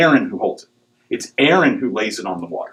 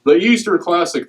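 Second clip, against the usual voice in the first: TV announcer voice; 7 semitones higher than usual. Retold or imitated. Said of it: "they say that every year on".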